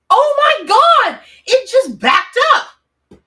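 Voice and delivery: high, loud, slow shriek of disbelief; shocked shout. Real, not acted. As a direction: she can't believe what she sees, yell it out. Truck reappears outside her window.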